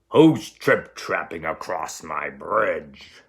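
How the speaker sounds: angry troll shouting from under the bridge; troll roar. Acted. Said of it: "a terrible roar rose up".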